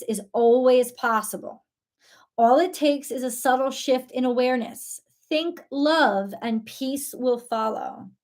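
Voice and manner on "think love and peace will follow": ordinary delivery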